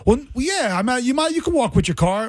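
lightly voiced chatbot, humouring a confused user; ChatGPT reply. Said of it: "Chat G P T is like".

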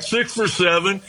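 ordinary talk, nothing marked

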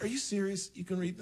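can't believe she reads lips from there; incredulous. Retold or imitated. To his date at the concert, high incredulous disbelief. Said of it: "I'm like".

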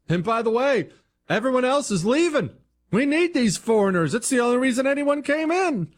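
high-pitched mock politician; mocking candidate impression. Host mocking a candidate's immigration answer, high mocking voice.

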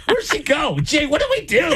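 very high, panicked mother; frantic mom. Retold or imitated. Frantic mom in goth skit.

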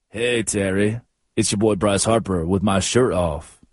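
low, lightly seductive jock; a hint of a sultry athlete; sexy voice. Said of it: "why is he talking like that".

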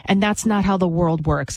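ordinary delivery

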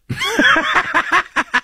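a big high burst of laughter at his own joke. Real, not acted. Host cracking up at his cruise joke, laughing high.

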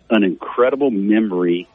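ordinary talk

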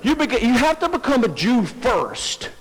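high-pitched, insistent correction; insistent objection. Acted. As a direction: cut in to lay down a strict condition. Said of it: "but their condition was".

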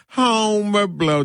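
slow drawn-out announcer call; mock announcer. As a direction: announce the name grandly. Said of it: "be like".

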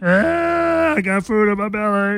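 put-on high, childish kid voice; five-year-old voice. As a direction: play a little kid showing off. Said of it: "like I'm a five-year-old".

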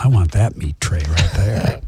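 low-pitched put-on Australian accent; mock Australian accent. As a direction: say it as a jokey Aussie, pitched well down. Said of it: "Is he from Australia?".

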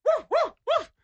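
very high yappy barking; squeaky imitation barks; dog barks. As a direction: bark like an eager little dog. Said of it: "And then".

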